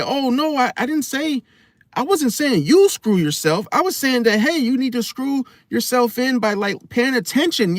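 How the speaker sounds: high, sing-song backpedalling; mock innocent Gemini. High mock-innocent excuse to a suspicious partner. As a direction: play innocent while twisting the words. Mocking Gemini flipping his words.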